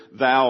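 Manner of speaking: "thou" hit hard and loud; emphatic thou. Shown as a demonstration. Preacher pointing out the Hebrew pronoun, emphatic. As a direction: punch out the word "thou". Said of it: "it's emphatic".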